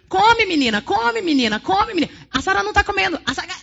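a pizzeria friend nagging the dieting woman, mock loud insisting; insistent nagging. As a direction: push food on her like a bossy aunt. Tablemate nagging her to eat.

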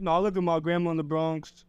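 normal talking voice

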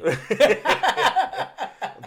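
high, loud burst of laughter; laughing. Real, not acted. Friend jokes "don't touch me", real burst of laughter. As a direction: crack up in a long high cackle.

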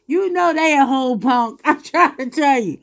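laughing through the words. Real, not acted. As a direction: chuckle while making the point. Laughing at her own joke.

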